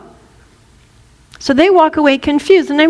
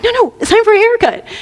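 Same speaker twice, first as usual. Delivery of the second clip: high, quick nagging voice; pitched-up caricature of maternal nagging; obnoxious mother; 9 semitones higher, faster than usual. Acted. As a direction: insist as a pushy mother. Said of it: "a typical mother of, of the obnoxious kind".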